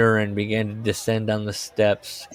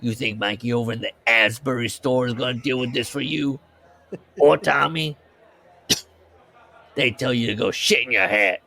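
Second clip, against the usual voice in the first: a put-on tough Jersey guy voice; put-on working-class voice, scolding the boss; gruff Lenny. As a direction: lay it out like a worn-down worker. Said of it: "Lenny's like".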